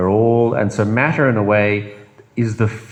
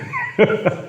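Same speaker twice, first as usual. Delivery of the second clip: bright high-pitched laugh; 12 semitones higher than usual. Real, not acted. After voicing the ego, high laugh. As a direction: burst into a laugh.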